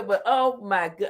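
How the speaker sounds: usual speaking voice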